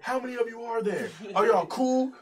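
mock interrogation of party guests; doorman challenge. Big dude questioning them at door.

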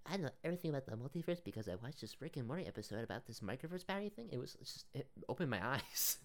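high, soft put-on know-it-all voice; mock a smug Rick and Morty fan.

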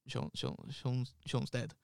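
halting, breathless repeat of the news; choked stammer. Retold or imitated. Telling his ex on the phone, a choked, faltering stammer. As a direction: stumble over the name, barely getting it out.

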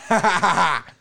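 loud, high imitation of her mocking laugh; mock cackle. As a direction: ham up a taunting laugh.

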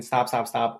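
usual speaking voice

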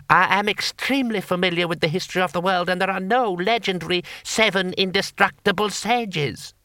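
high, fast, lofty voice; haughty lord. Acted. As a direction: rattle off superior certainty. Lord Hong lecturing.

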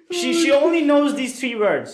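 high laugh into words; laughing hard. Real, not acted. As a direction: laugh high while speaking. Laughing at own story.

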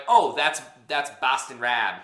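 loud mock Boston accent with a flattened "Rob". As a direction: ham up a Boston accent, slowing on the name. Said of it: "that wasn't a good Boston accent".

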